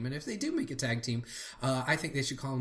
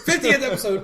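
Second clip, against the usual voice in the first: excited cheer; 12 semitones higher, 10 dB louder than usual. Real, not acted. Echoed louder and higher.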